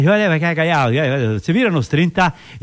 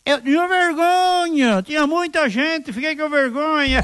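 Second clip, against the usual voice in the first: shy dog voice; 10 semitones higher, slower than usual. Acted. Dog's punchline voice.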